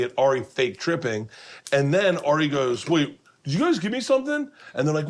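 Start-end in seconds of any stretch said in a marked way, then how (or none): 2.9-4.5 high-pitched, put-on worried question; acted-out alarm; mock alarm. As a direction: imitate someone suddenly suspicious, pitched high. Acts fake tripping Ari's alarm.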